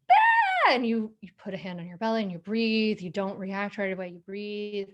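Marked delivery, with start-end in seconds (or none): 0.1-0.7 sudden high yelled shriek; mock kid freaking out, loud; panicked cry. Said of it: "they start to go".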